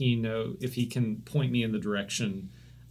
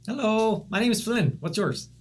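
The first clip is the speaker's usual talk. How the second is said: high, bright-eyed little gnome; chirpy little gnome greeting a stranger; gnome Flynn voice; 10 semitones higher, 5 dB louder than usual. Acted. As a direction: pipe up eagerly like a small gnome.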